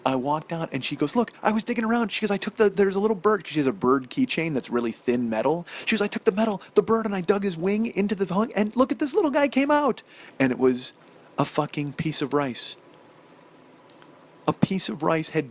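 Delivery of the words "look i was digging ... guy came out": high, breathless proud chatter; bubbling over with pride; excited wife. Retold or imitated. Wife excitedly explains the fix.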